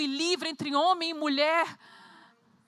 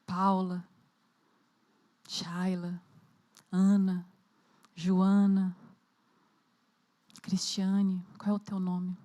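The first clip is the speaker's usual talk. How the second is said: guided meditation; 7 semitones lower than usual. Acted. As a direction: lead them gently into the scene. Guided imagination of the tomb scene.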